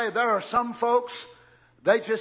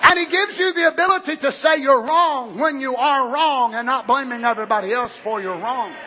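raised preaching voice; 4 semitones higher, 6 dB louder than usual. Real, not acted. Preacher exhorting his church, full force.

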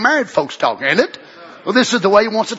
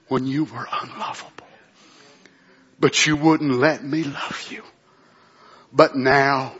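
much lower and softer, slow and hushed; kind tender voice; 8 semitones lower, 5 dB quieter, slower than usual. Acted. God answering his child, hushed and tender. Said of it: "tender voice talking back".

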